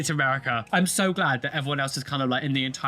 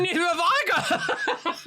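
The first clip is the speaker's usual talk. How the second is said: laughing; 11 semitones higher, slower than usual. Real, not acted. Laughing reply.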